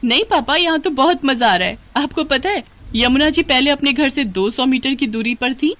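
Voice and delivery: put-on excited young daughter-in-law. Daughter on the phone telling papa about the river, excited. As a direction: chatter happily, full of innocent enthusiasm.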